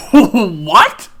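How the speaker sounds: high, loud yell through laughter; laughing yell. Acted. David yelling at Kyler, loud and laughing. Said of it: "he yelled, laughing".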